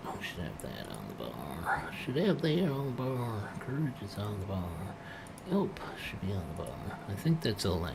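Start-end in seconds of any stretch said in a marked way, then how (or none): 0.1-1.9 whispered to self. Real, not acted. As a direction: breathe it out unvoiced. Muttered to self, unvoiced.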